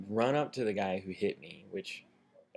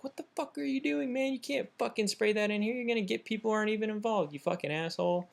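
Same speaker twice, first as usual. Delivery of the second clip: imitated crowd yelling; 12 semitones higher than usual. Bystanders yelling at pepper sprayer, high mock outrage. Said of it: "people are all yelling at him".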